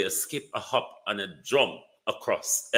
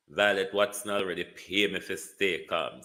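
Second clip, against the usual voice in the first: normal talking voice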